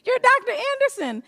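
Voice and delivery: high-pitched mock delight; hammed-up awe at meeting somebody important; gushing recognition. Usher greeting a supposed celebrity, gushing. Acted woman pretending to recognise her.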